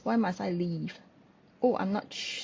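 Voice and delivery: ordinary talk, nothing marked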